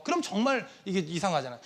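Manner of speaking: usual speaking voice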